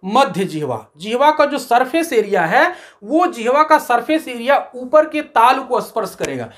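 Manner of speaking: usual speaking voice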